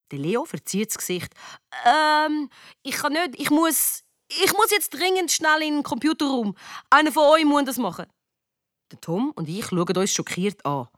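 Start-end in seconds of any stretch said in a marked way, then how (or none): 1.8-8.1 high, halting, then rushing out; flustered excuse. Acted. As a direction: squirm out of it, pitched high. Leo dodges asking her, makes excuse.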